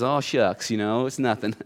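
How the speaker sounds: a humble believer waving off the praise in a soft mumble; bashful mumble. Retold or imitated. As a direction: play the modest saint shrugging off praise, softly. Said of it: "looks down at his feet and says".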